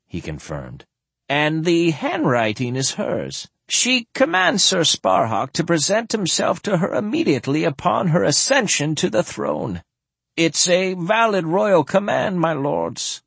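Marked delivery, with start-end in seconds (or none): 1.3-13.2 put-on higher, thin elderly lord voice; an old noble speaking carefully; old man voice.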